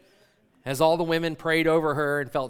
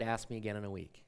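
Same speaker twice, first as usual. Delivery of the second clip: quiet repeat; 6 semitones lower, 10 dB quieter, faster than usual. Retold or imitated. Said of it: "why do you think she said".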